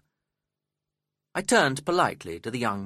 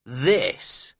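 mild scorn on one loud word; single word set apart with scorn; touch of contempt, louder; scornful; 3 semitones higher, 5 dB louder than usual. A narrator's reading. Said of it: "looking scornfully at the young man".

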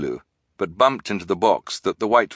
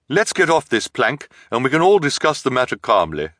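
higher-pitched put-on voice; disguised voice; 6 semitones higher, 4 dB louder than usual. A narrator's reading. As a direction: reason with them in an assumed voice. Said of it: "in her low, disguised voice".

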